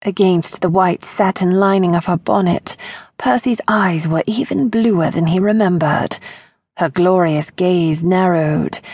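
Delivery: ordinary delivery, nothing marked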